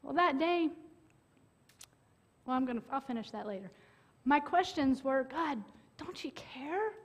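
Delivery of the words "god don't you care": whispered plea to God. Retold or imitated. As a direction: breathe it out without voice. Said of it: "whispered question to God".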